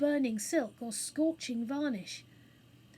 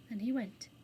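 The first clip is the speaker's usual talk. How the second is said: ordinary delivery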